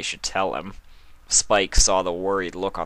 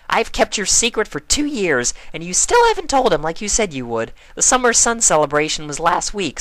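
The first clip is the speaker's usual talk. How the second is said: higher, louder, insistent; firm Pinkie; 11 semitones higher, 5 dB louder than usual. Acted. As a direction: stand your ground with a friend. Pinkie firmly confronts Twilight.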